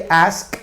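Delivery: "Ask" with a released, voiceless sk; voiceless ending. Shown as a demonstration. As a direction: let the final k of "Ask" click without voice. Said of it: "ahí no vibran las cuerdas vocales".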